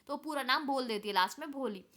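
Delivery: ordinary talk, nothing marked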